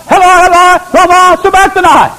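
high, loud anguished cry. Acted. Jesus on the cross crying out to God, anguished loud cry. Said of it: "he cried out".

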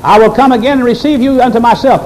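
"I will come again" pitched high and driven through to "myself"; high, forceful preacher's quote from "I will" to "myself"; emphatic promise. Shown as a demonstration. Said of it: "it's emphatic".

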